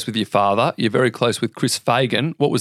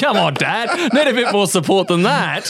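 higher, laughing through the words; laughing tease; 9 semitones higher than usual. Real, not acted. Host teasing the guest's father, laughing and higher. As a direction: tease the dad while laughing.

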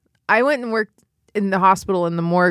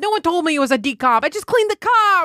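funeral director impression; 11 semitones higher than usual. Funeral director panicking over his clean car, high frantic whine. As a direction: squeal it like a frantic little New York man. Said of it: "he goes".

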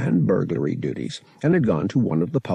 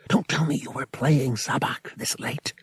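hushed, higher-pitched disbelief; puzzled; 5 semitones higher than usual. A narrator's reading. Boy questioning Wookiee in dead of night, hushed and puzzled. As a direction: keep the voice down, baffled.

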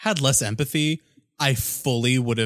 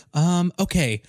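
put-on nervous kid voice; 5 semitones higher, slower than usual. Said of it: "And he's like".

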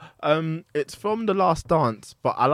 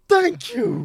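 high mock meme voice; high-pitched meme quote; quoting The Office. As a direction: squeak out the meme line. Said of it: "he's like".

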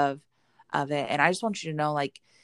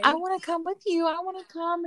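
high-pitched whiny kid impression; imitating crying kids; 13 semitones higher than usual. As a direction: plead like a clingy little child, much higher. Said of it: "three crying babies at my door that are like".

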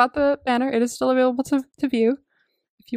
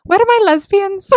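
high, loud playful holler; hammed-up movie-quote shout; quoted joyful call; 10 semitones higher, 6 dB louder than usual. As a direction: call out high across the supermarket. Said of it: "suddenly we'll go".

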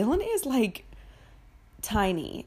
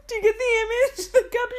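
laughing; 4 dB louder, faster than usual. Real, not acted. Laughing at own story.

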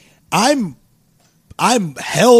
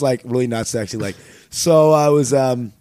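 usual speaking voice

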